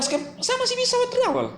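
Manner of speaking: high mocking parody of a critic; mocking imitation. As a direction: parody him in a silly high voice. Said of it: "Dia bilang".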